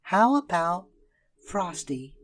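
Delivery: a hint of a girl's voice; little girl asking. A narrator's reading. Karen suggesting a name for the snowman. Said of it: "asked Karen".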